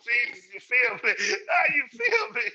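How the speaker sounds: high, laughing exclamation; laughing outburst. Real, not acted. Host laughing at being told to see somebody, high laughing outburst. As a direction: burst out laughing, pitch shooting way up.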